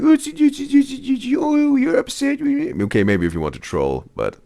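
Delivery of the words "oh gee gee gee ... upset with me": put-on very high cooing baby talk. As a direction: coo like talking to an infant. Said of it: "And you'll be like".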